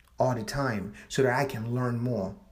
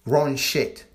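usual speaking voice

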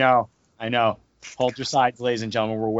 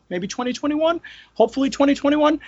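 hopeful; 12 semitones higher than usual. Acted. Hopeful guess at release date.

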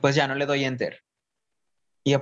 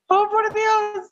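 amazed gasp; 3 dB louder, much slower than usual. Retold or imitated. Reacting to being accepted.

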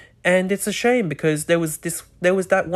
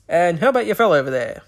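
quick, showy emcee patter; stage voice; 3 dB louder, faster than usual. Shown as a demonstration. Host demoing a stage voice to the audience, quick and showy. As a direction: rattle it off like a game-show host. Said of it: "they'll be like a stage voice".

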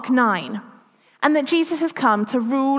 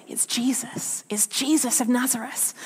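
hushed crowd whisper, softer; a murmur rippling through the crowd; whispered rumour; 6 dB quieter than usual. Retold or imitated. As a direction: pass the news along in a whisper. Crowd passing rumour along.

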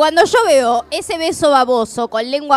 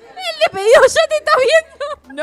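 much higher, louder mocking tease; teasing sing-song; 14 semitones higher, 6 dB louder than usual. Real, not acted. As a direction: taunt her like a schoolkid. Burla a su compañera. (burla cantada, mucho más aguda)

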